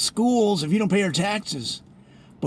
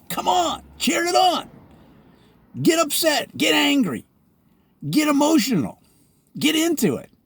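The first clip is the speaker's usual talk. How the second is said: excited shouting; 7 semitones higher than usual. Real, not acted. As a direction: whip the crowd into yelling, really worked up. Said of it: "I'm getting too excited".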